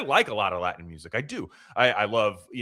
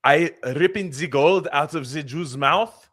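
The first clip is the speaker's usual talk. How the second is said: slow, loud, higher caricature voice; hammed-up villain confessing slowly and loudly; Nazi villain; 6 semitones higher, 6 dB louder, slower than usual. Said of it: "a guy with, like, swastikas".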